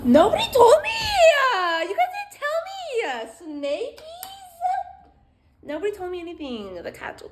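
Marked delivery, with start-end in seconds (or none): 0.1-4.6 high, loud whiny cry of betrayal; mock wailing. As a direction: wail it, dragging out the last words. Mock complaint nobody told her.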